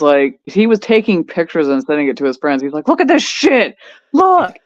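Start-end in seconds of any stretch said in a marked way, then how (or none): 2.9-4.6 mad ex-husband. Retold or imitated. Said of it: "like, legit fucking mad".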